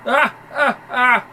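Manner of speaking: high loud mocking cackle. Thug cackling at Brian's exterminator, high and loud. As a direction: cackle to ridicule the old man.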